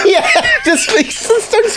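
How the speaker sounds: very high laughing talk; words cracking with laughter. Real, not acted. Laughing while retelling.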